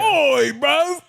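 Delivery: very high squeaky mock Cockney; shrieking hammy Brit voice; British accent. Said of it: "That's an Australian Bond".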